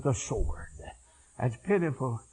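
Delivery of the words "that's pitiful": normal talking voice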